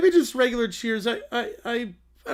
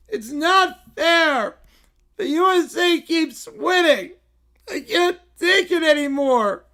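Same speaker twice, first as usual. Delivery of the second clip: whiny Caillou; 4 semitones higher, 3 dB louder than usual. Acted. Caillou whining, crying out.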